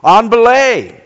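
loud call up the rock; climbing call. Shown as a demonstration. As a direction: call it out to your partner.

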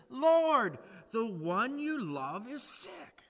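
loud, high cry of the sisters; urgent plea. Retold or imitated. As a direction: call out, Lord, he is sick. Sisters' urgent message voiced.

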